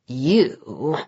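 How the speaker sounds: low, soft venom in one word; venomous. A narrator's reading. Vlad greets his unwelcome rival, low and venomous. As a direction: spit it out low with contempt. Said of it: "the venom in that one word".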